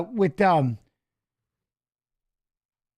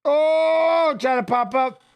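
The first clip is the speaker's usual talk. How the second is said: excited reaction; 7 semitones higher, much slower than usual. Real, not acted. Choke lands in fight.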